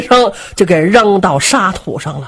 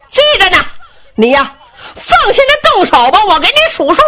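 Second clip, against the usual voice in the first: high-pitched cheering reply from below; shouting back up; 11 semitones higher, faster than usual. Acted. Tong Huan answering his brother from below, shouting back. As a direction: call it up to the stage, high and eager. 铜环在台下喊着答话.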